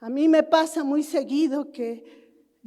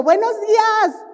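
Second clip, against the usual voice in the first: loud, high cheerful call across the street; loud shouted good morning; shouted greeting; 8 semitones higher, 6 dB louder than usual. Retold or imitated. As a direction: call out the greeting loudly to the neighbour. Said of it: "y yo grito".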